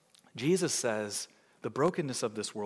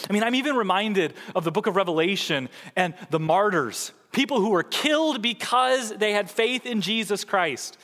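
building, louder preaching; rising sermon; 8 semitones higher, 6 dB louder than usual. Acted. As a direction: lift the congregation toward the martyrs. Preaching rises again.

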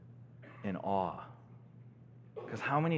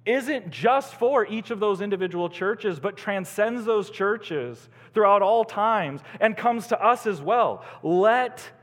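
raised, louder preacher's voice; forceful preaching; 10 semitones higher, 9 dB louder than usual. Real, not acted. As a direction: proclaim it urgently to the congregation.

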